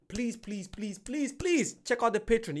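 plead, pushing harder on the last please. Real, not acted. Host begging viewers to check out the Patreon, pleading.

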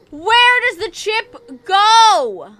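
slow, loud, exasperated question; loud slow exasperated whine; frustrated yell. Real, not acted. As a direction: draw it out in despair. Frustrated searching for chip.

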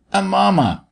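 put-on high, insistent little-boy voice. A narrator's reading. Sammy pressing the doctor again, insistent child. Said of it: "Sammy insisted".

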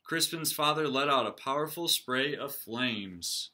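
lively read-aloud for the class; storybook reading. A narrator's reading. Teacher reading a picture book aloud to her class. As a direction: read it like story time for kids.

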